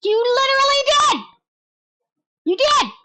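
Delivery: high gleeful shouting; gleeful taunting. Real, not acted. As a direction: crow it at him.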